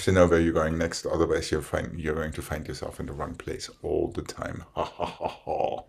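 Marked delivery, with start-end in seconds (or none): none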